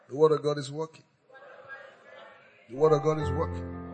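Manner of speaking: normal talking voice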